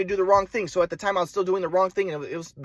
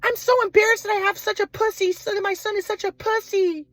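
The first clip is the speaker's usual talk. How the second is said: high-pitched hysterical mock-mom tirade; angry mom. Mom berating her embarrassing son, high angry screech. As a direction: mimic a furious mother in a piercing high voice.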